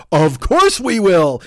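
loud, drawn-out gung-ho yes; mock eager agreement. News crew jumping at the chicken story, mock eager. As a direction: belt it out slowly, all in. Acting eager TV crew's reply.

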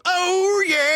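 high loud rock star yelp; [name] impression. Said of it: "[name] soundboard".

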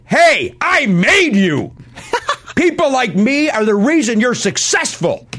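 hammed-up protest at the star; mock indignant outburst.

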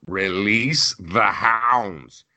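slow, drawn-out villainous order; mock command. Host imagining himself as president, mock command. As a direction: stretch each word out like a theatrical command. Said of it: "'Cause I would have had".

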